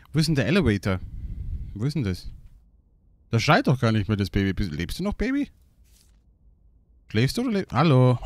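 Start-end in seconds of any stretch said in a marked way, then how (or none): none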